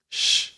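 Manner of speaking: long sh into silence; hushing sh. Shown as a demonstration. Teacher ending finish in silence, hushing sh. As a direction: let out a hushing sh. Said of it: "and you want it to".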